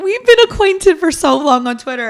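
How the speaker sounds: high, loud thrilled gushing; excited gush. Retold or imitated. Gushing at Twitter friend Nancy met in class, high loud excitement. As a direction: gush at her like an old friend finally met.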